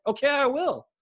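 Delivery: high, loud comeback; retold defiant retort. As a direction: throw it back at mom as a challenge. Retold retort to annoyed mom.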